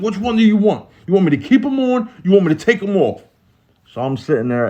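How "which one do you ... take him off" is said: high, agitated mock anger; getting mad. Cellmate snapping at guards over contradictory orders, getting mad. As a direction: imitate a cellmate losing patience, pitched way up. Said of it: "he started getting mad".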